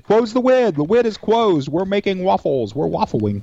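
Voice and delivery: lisping cartoon hunter, W sounds for L; Elmer Fudd voice. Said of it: "Those rascally wabbits".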